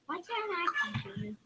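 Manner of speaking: normal talking voice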